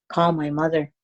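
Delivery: ordinary delivery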